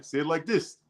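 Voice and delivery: high, soft line reading with a lift; coaching producer. Retold or imitated. Producer coaching ads line by line, high imitated voice. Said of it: "Line by line".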